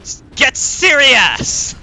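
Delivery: slow, loud mock-serious yell; half-joking shout. Real, not acted. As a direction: bellow it at yourself, half laughing. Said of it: "Angry mode".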